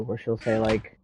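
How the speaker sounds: normal talking voice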